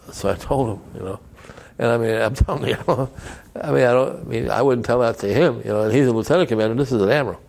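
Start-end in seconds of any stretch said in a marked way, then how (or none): 1.8-7.4 louder and faster, laughing through the disbelief; a giddy rush, still amazed he said it; excited, laughing retelling. Real, not acted.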